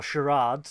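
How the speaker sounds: "charades" with a long AH, shuh-RAHDZ; alternative dialect pronunciation. Shown as a demonstration. Correcting co-host on the episode title, "charades" said shuh-RAHDZ. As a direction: say "charades" to rhyme with "cards". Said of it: "depending on your dialect".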